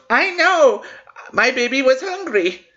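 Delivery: high, whiny mock of the entitled mom; mocking entitled mother. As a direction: sneer it back as the smug mother. Entitled mother's reply retold.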